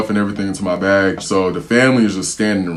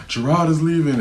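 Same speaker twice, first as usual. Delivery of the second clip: pitched way up in an imitated wail; mock hysterical mom; 8 semitones higher than usual. Mom tells husband he's leaving, mock hysterical and high. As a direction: play her upset, mostly in pitch. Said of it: "being real hysterical and stuff".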